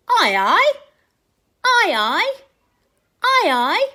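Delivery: loud, bright salute call; little sailor boy saluting. Acted. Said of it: "he puts his hand to his head and he says".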